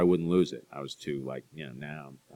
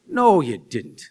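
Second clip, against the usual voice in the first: higher, louder mock Irish lilt; Irish accent; 5 semitones higher, 7 dB louder, slower than usual. Talking to an Irish relative and copying them, Irish accent. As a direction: echo an Irish relative's cadence. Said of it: "talking to an Irish relative".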